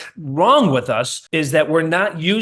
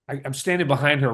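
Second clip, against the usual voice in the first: ordinary talk, nothing marked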